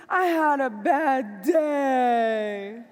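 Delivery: slow, mopey whine; mock whining. Husband telling wife about his day, mock whine. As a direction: drag it out like a sulking husband. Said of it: "whining, crying".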